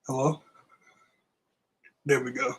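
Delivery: usual speaking voice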